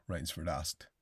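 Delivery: plain reading voice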